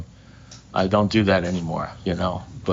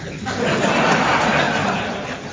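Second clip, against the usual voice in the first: airy high-pitched laugh at his own punchline; breathy high laugh; 12 semitones higher than usual. Real, not acted. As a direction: break into a wheezy laugh.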